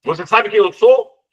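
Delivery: high, loud startled question; shocked question. Acted. Jean Valjean startled that the bishop knows him, high and loud. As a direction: gasp out the question in alarm. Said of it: "arregala os olhos".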